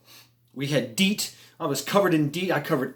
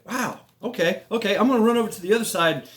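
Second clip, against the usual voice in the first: hurried excitement; 6 semitones higher, faster than usual. Retold or imitated. Recalls rushing decision.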